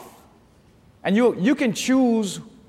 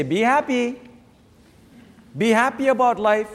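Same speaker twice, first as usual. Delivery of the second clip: mock quote; 5 semitones higher than usual. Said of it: "James doesn't say".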